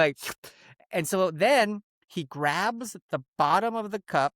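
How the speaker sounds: usual speaking voice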